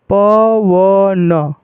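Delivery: पवन very slowly, each syllable held long and level; drawn-out pronunciation. Shown as a demonstration. Teacher revealing sounds by pronouncing पवन, very drawn out. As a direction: stretch पवन into प, व, न. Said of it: "उच्चारण करने से ही पता चल जाएगा".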